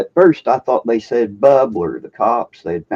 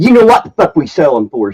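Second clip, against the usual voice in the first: louder, snippy retold comeback; curt clerk; 4 semitones higher, 7 dB louder than usual. Clerk brushing off the customer, mock curt. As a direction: snap it back like an annoyed clerk. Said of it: "She said".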